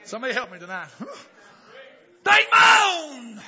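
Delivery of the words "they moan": high, very loud drawn-out cry; preacher's shout. Real, not acted. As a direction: belt it out, stretching the last word. Preacher shouts the key phrase.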